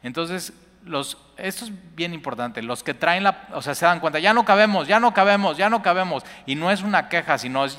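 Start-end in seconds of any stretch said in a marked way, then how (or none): 4.2-6.2 loud, high, urgent ya no cabemos; eager outcry. Acted. Disciples telling each other there is no room, loud and eager. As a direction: cry it out like excited disciples. Prophets realise they lack room.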